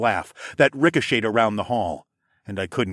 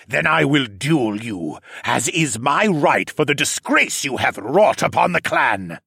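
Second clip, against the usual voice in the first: formal bellow; 4 semitones higher, 3 dB louder than usual. A narrator's reading. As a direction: proclaim the challenge like a wronged noble. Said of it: "Siskern bellowed".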